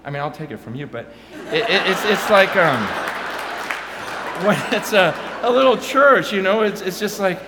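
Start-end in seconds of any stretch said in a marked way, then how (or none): 2.9-4.4 a silent, breathy burst of laughter; breathless laugh. Real, not acted. Telling the crowd about small churches, breathless laugh. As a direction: laugh it out without voice.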